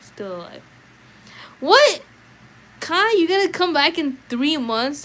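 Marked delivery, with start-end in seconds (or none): 1.6-5.0 high, loud disbelieving cry; shocked disbelief. Real, not acted. Streamer hears Kai is leaving for three months, shocked. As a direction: react in real shock to the news.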